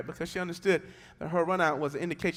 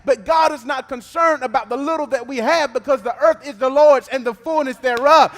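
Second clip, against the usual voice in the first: rising, much louder sung preaching cadence over music; full-force preaching; 11 semitones higher, 18 dB louder than usual. Acted. Pastor preaching to the congregation, sung and shouted.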